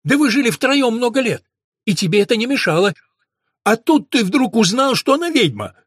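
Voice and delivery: loud, high indignant outburst; blurted out boldly. A narrator's reading. Dan lashing out at Mahmed, loud and indignant. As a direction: blurt it out, rising in outrage. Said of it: "набрался наглости и выпалил".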